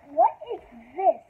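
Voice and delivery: loud, sudden what-is-this; surprised. Real, not acted. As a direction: blurt it out in surprise. Reads picture book, surprised aside.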